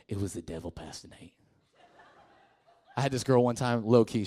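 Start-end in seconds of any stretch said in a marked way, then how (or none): 0.1-1.3 whispered sheepish excuse; mock whispered excuse. Pastor imitating a teen whispering excuses to him. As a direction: breathe it out like a guilty confession. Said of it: "let me tell you what you usually tell me".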